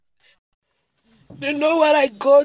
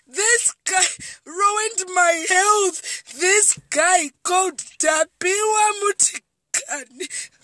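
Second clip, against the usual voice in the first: slow, crying cry-out of his name; sobbing accusation; 3 semitones higher, slower than usual. Real, not acted. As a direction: sob it out, naming him.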